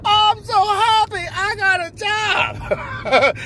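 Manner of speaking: high, slow, giddy voice of a man who just got a job; overjoyed new hire. Acted. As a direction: squeal it out like the happiest man alive. Character answers the interviewer.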